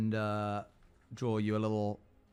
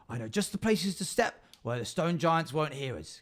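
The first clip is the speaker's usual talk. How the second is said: higher, eager goblin guide; 5 semitones higher than usual. Acted. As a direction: coax them along like a sneaky goblin. Goblin leads through woods.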